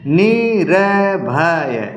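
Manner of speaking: निर्भय stretched out syllable by syllable; slow and easy. Shown as a demonstration. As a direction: draw out each sound of निर्भय, very slowly. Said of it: "आराम आराम से".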